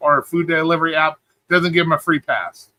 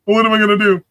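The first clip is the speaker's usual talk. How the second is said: higher-pitched mimicry of a worrier; mock panic; 4 semitones higher than usual. Streamer imitating everybody worried about being taken, mock panic. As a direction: play up everybody's helpless fretting. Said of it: "Everybody's like".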